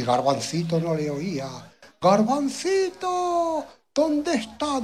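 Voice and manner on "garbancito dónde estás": the father shouts for his son across the fields; father calling out. Acted. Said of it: "decía el papá".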